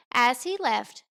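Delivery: usual speaking voice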